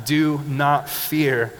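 drawn out, every word weighted; slow, loud congregation recital. Shown as a demonstration. Preacher leading the church in a slow, loud recital. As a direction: lead the room in saying it slowly. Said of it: "One more time".

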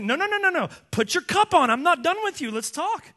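loud, high-pitched interruption; refusing to relent. Acted. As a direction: cut him off loudly and call him back to the fight. God cuts off Job's apology.

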